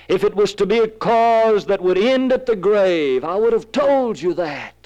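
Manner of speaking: high-pitched, fervent preacher's plea; impassioned assurance. Acted. Jesus assuring disciples he would have warned them, impassioned. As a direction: insist on it with rising fervour.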